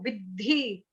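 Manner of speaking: विद्धि with force on the द्ध; stressed pronunciation. Shown as a demonstration. Teacher correcting the student's soft Vidhi. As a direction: hit the द्ध in विद्धि hard. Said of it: "थोड़ा दम लगाओ".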